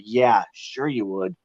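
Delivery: slow, dry disbelief; sarcastic. Retold or imitated. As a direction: drag it out, not buying it. Doubting the team's promise.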